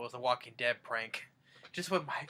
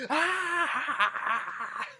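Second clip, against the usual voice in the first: imitated scream; 14 semitones higher, 4 dB louder than usual.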